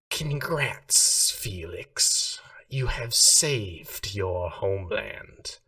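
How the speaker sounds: slow, hissing otherworldly voice, the words drawn out. Acted. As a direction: hiss it out like a serpent god gloating over Felix. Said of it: "it is a hissing, strong voice that fills your head".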